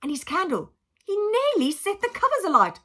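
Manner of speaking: plain reading voice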